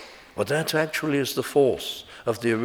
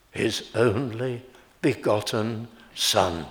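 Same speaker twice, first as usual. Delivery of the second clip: only leaned on, slow and falling; lingering weight on only; stress on only. Shown as a demonstration. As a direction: hold the word only, slow. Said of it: "The emphasis is to be placed... on the word only".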